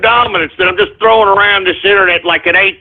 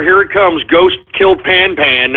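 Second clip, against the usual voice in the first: ordinary talk, nothing marked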